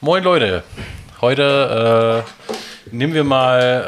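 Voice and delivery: bright, loud hello to the listeners; loud greeting. Real, not acted. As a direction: call out the welcome.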